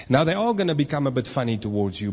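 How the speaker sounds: ordinary delivery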